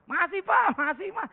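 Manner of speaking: high, loud insistence that all is fine; mock-cheerful kid insisting there is still money; children's reply. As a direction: chirp it back brightly to reassure dad.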